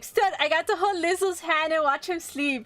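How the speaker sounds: giddy mock boast to a jealous friend; gloating brag. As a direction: rub it in, delighted. Bragging to rival Stud.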